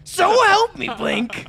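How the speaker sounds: high exasperated outburst; loud harsh voice. Acted. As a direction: blow up at your teammate. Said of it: "Stop yelling at me".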